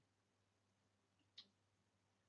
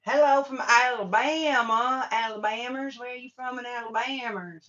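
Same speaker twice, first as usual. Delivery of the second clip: put-on Alabama accent; 6 semitones higher, 4 dB louder than usual. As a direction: greet them like her friend from Alabama, thick Alabama twang. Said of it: "That's how she talks, like this".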